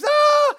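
a sudden high, loud shriek of outrage; acted scream. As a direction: scream it out sharp and high like the angry wife. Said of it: "Just screams".

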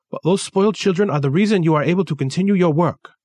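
put-on firm Kensuke, high with his Japanese accent. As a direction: assistant gently correcting his boss, Japanese accent.

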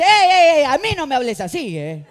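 loud, high, laughing rebuke; mock offended Jesus. Preacher playing Jesus scolding the disciples, loud mock rebuke. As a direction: play Jesus scolding, tongue in cheek. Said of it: "Jesús no se para y dice".

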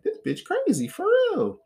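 high disbelieving inner voice; inner disbelief. Retold or imitated. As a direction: sound stunned at the craziness. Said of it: "And I'm sitting there just like".

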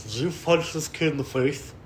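slow, lip-tight principal voice; principal lisp. Retold or imitated. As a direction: speak through teeth and bottom lip. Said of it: "top teeth and his bottom lip".

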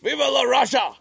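high loud defiant cry; mock cry. Brother crying out before his hanging, high and loud. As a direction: shout it defiantly before death. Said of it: "he was like".

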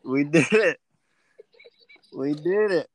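loud happy cheer; excited cheer. Real, not acted. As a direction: celebrate out loud. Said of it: "You sound like a four-year-old".

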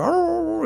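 high, held imitation howl; dog howl. Owner imitating his dog wanting attention, high howl. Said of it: "she'll howl at you wanting attention".

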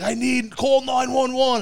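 high, loud, drawn-out mock plea; panicked plea. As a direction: imitate a man begging for emergency help. Panicking man begs for ambulance.

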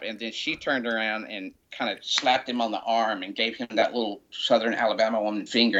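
ordinary talk, nothing marked

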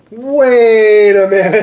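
slow, loud dawning realization; sudden realization. Retold or imitated. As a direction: stop short, it just clicked. Realizing mid-drive.

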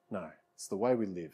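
normal talking voice

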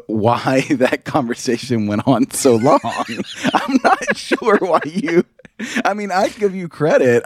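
talking through laughter, voice climbing high; laughter pushing the words up and out slow; laughing. Real, not acted. Teasing a friend about a long awkward chat, laughing.

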